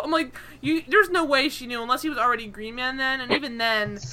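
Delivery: normal talking voice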